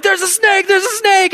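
high, frantic repeated alarm; panicked shriek. Retold or imitated. Husband alerting his wife to a snake, high panicked shriek. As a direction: shriek a hammed-up warning.

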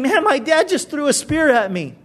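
a mock hurt son, mild; imagined wounded son. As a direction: son griping about his father, voiced lightly. Voicing Jonathan's imagined complaint.

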